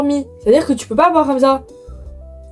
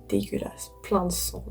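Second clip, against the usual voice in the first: whispering; 3 semitones lower, 14 dB quieter than usual. Retold or imitated.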